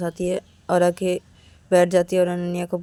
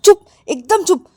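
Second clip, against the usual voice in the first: loud, high-pitched command; angry hushing; 9 semitones higher, 9 dB louder than usual. Acted. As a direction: shut them up sharply. Acted order to shut up. (गुस्से में चुप कराते हुए)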